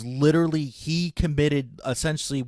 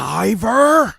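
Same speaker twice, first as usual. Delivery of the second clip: loud, high battle cry; transformation shout; 8 semitones higher, 8 dB louder than usual. Retold or imitated. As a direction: yell it like a hero transforming. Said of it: "And he says".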